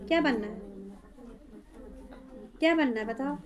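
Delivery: normal talking voice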